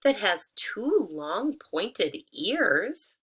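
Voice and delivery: very slow, pointed hen voice. Acted. Hen noting the pointed ears, slow and deliberate. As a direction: spell out each odd detail slowly. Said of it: "she said".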